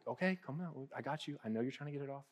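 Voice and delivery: tender, hushed reassurance; reassuring parent. Retold or imitated. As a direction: soothe the upset child.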